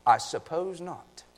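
understated put-on English accent. Preacher glossing an old word for the congregation, English accent. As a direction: try your best English accent, lightly. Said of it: "In my best English accent".

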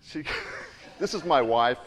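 normal talking voice

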